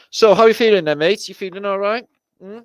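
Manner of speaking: high, loud coo to the toy; doting pet voice. Pretend. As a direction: fuss over him like a sick pet. Voicing concern to the toy Hank.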